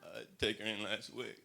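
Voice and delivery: a hint of a good old boy; Texan guy. Two Texan guys talking about a truck, lightly drawled. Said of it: "Dude's like".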